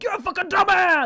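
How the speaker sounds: loud, high angry heckle; imagined heckler. Pretend. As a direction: bellow it like a nasty heckler. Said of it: "someone's just gonna be like".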